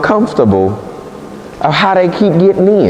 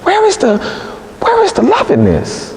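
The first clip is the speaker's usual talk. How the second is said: much higher, slow, hurt questioning; Delilah pleading; 5 semitones higher, 3 dB quieter, slower than usual. Acted. Delilah begging Samson, much higher and slow. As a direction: ache for love she can't find. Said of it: "She says".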